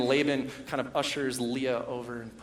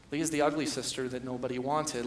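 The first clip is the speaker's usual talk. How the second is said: usual speaking voice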